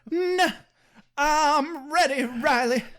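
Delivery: high, slow, overdone breathless heroine; milk the innuendo in a mock Buffy voice; hammed-up dramatic reading. Mock Buffy offering herself to Riley, hammed-up dramatic reading. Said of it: "It is not dramatic reading worthy".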